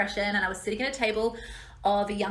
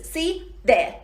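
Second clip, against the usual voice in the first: triumphant; 8 semitones higher, 6 dB louder than usual. Retold or imitated.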